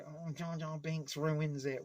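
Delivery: slow, whiny mocking of the critics. Mocking prequel critics complaining about Jar Jar, whiny. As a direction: sneer it like a fussy fan. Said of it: "there was a lot of criticism".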